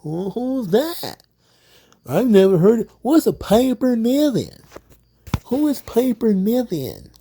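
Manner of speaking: hammed-up baffled wrestlers; mock confused roster. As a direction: act like you have never heard the name. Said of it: "Everybody's just like".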